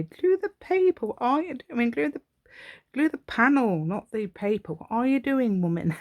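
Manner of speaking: high-pitched, exasperated telling-off; mock scolding viewer. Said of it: "I bet you was telling me".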